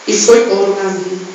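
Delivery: ordinary talk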